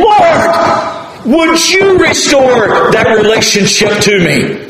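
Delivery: high, shouted plea to the Lord; David crying out. Acted. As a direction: beg for restoration at full voice. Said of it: "he's saying".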